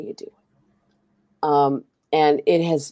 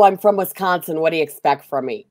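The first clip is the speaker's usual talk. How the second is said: mock police quote; 3 semitones higher, 7 dB louder than usual. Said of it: "the police came out and said".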